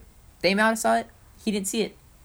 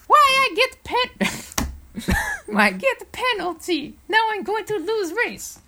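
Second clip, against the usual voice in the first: much higher, slow, silly voice with broken grammar; mocking high voice; 5 dB louder, slower than usual. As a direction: whine it in a squeaky cartoon voice. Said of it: "So he was like".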